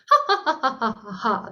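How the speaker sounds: rapid string of staccato "ha"s; laughter exercise. Shown as a demonstration. Voice teacher showing a fire-activating sound, staccato laughter. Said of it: "One such sound is like laughter".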